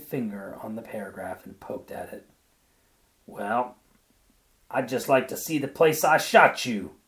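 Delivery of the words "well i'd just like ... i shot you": loud, higher outburst; husband exploding at his wife over the news story; explosive cry. A narrator's reading. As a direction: burst it out at her. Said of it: "he cried explosively".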